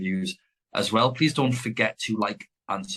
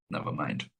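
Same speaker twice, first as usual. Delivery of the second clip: whispered aside; 8 semitones lower, 8 dB quieter than usual. Real, not acted. Quick aside after a rant.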